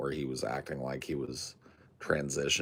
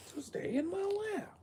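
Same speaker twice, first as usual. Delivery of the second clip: high, soft pet talk; 7 semitones higher, 6 dB quieter than usual. Real, not acted. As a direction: coo to the animal in your lap. Talking to his pet.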